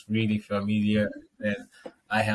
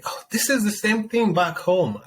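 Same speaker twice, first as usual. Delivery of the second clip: surprised realization; 11 semitones higher, 4 dB louder than usual. Retold or imitated. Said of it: "I'm like".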